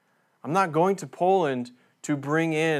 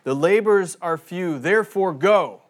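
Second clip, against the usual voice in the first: louder, higher command on "therefore go"; emphatic misreading; 5 semitones higher, 7 dB louder than usual. Shown as a demonstration. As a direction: bark "therefore go" like an order. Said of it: "it doesn't say".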